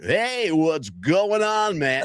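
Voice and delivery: high, very slow, stretched broadcaster greeting; overdone announcer voice. Retold or imitated. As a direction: ham it up like a radio announcer trying too hard. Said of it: "he's trying too hard".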